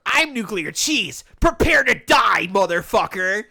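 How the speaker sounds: hammed-up trash-talk yell; mock villain taunt. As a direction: announce yourself like a boss before the fight. Voicing the rival's taunt.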